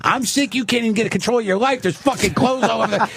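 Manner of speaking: fast, high-pitched mock tirade; retold yelling. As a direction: act out an argument without real volume. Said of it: "we're both yelling at each other".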